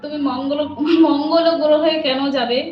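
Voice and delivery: ordinary talk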